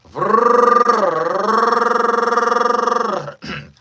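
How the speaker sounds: loud, high sustained tongue trill; buzzing warm-up trill; long rolled R trill. Shown as a demonstration. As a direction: roll the R at length. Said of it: "to roll my R's".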